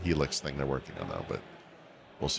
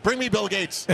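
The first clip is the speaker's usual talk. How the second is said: cartoon voice; 12 semitones higher than usual. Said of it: "he's like".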